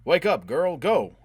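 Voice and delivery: high, loud urging; urgent shout. A narrator's reading. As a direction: shout to jolt her into action. Urging her during the raid.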